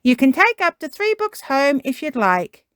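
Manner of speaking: high, loud good-news announcement; Miss Rabbit. Acted. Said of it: "Miss Rabbit has good news".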